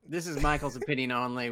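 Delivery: normal talking voice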